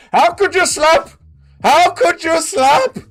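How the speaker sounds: hammed-up Indian fighter trash talking; mock Indian trash talk. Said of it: "Indian trash talk in a press conference".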